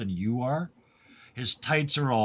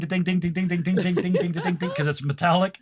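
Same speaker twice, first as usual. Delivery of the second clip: repeated high, louder dings like a bell; mock metal ding; 6 semitones higher, 3 dB louder than usual. GM playing the metal spear's ringing, repeated dings. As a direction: chant the dings like a struck pan.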